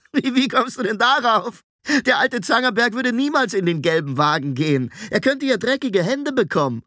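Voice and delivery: put-on high, amused boyish apprentice; mock the idea, tickled by it; giggly, high-pitched teasing; cackling boy. A narrator's reading. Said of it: "gackerte wie ein betrunkenes Huhn".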